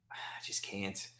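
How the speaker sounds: whispered, giving up; defeated whisper. Real, not acted. Fan whispering to viewers after a touchdown, defeated. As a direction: breathe it out, defeated.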